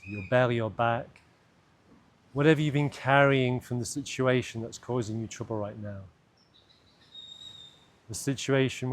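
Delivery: calm, unhurried meditation voice with long pauses; guided meditation. Acted. Guide leading listeners into an imagined cave, guided meditation. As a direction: lead them gently inward, leaving space between phrases.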